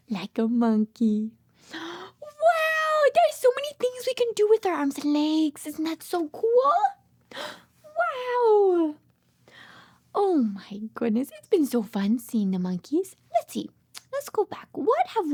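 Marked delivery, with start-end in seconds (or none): none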